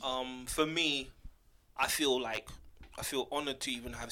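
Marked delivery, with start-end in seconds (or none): none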